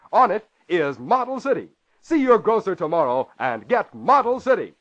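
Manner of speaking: normal talking voice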